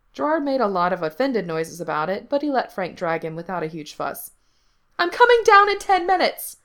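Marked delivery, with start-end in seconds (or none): 5.0-6.6 high, loud indignant yell; full-voiced kid's holler, pitch way up. A narrator's reading. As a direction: shout a warning from another floor. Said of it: "Gerard yelled".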